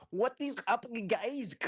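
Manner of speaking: put-on high, loud, drawn-out mystery European accent; slow, squeezed parody of a Brazilian-European accent; mock Brazilian-European accent. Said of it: "half Brazil, half fucking European".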